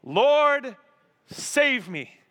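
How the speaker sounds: high, loud cry for rescue; acted plea of desperation; desperate prayer. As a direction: cry out like a man going under. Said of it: "he prays a prayer of desperation".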